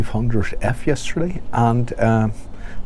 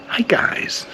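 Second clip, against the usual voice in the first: hi guys jumping way up in pitch; chirpy youtuber hi guys; high bright hi guys; 14 semitones higher than usual. Shown as a demonstration. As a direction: hi guys tried out high and breathy. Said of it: "try and do the guys thing".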